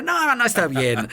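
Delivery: tono agudo de niño apenado; imitando. Retold or imitated. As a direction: imita al niño que disimula, agudo y lento. Niño avergonzado ante sus amigos. (voz aguda de niño avergonzado)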